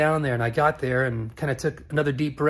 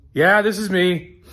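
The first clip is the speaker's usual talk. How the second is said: higher, louder, slow gruff retort; gruff owner voice; 4 semitones higher, 6 dB louder, slower than usual. Retold or imitated. Vlogger imitating a rude shop owner, gruff and curt.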